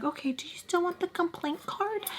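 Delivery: high, soft, sugary question; mock saccharine clerk voice; sweet library lady. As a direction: coo it like a fake-nice clerk.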